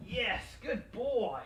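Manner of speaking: slow, sing-song praise for the dog; drawn-out praise. Real, not acted. Trainer praising the dog, slow and upbeat. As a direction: stretch it out, rewarding the pup.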